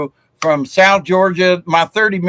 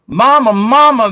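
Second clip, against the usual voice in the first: put-on boy crying out; 7 semitones higher, 5 dB louder than usual. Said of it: "the little boy cried".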